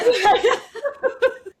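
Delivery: loud, high burst of laughter. Real, not acted. Host laughing with her guest, high loud laugh. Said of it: "laughs with her guest".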